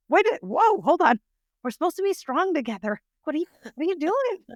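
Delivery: high, loud, flustered retold alarm; startled whoa. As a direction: replay your own startled backpedal. Teller reacts to girl bawling.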